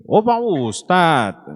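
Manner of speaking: high, drawn-out "oh" of sudden recognition; surprised realization. Retold or imitated. Driver learns his passenger is a preacher, surprised realization. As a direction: act out an astonished, long-stretched exclamation.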